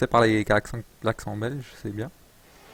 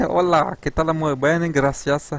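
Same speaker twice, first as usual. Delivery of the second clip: higher, louder hammed-up Spanish accent; mock Spanish greeting, pitched up and punchy; 6 semitones higher, 4 dB louder, slower than usual. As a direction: ham up an amateur Spanish accent. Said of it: "C'est l'accent espagnol".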